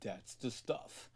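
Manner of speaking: very slow, very soft put-on voice; imitating savoured approval. Star tastes his long-awaited coffee, hushed savouring. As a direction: draw it out quietly like a connoisseur savouring a sip.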